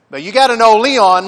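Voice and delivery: ordinary talk, nothing marked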